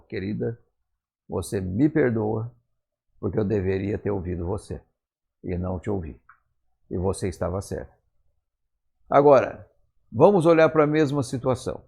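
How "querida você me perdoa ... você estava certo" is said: soft, lowered voice, humbly admitting fault; tender apology. Retold or imitated. Husband comes back to ask his wife's forgiveness, tenderly. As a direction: confess it quietly and gently to a loved one.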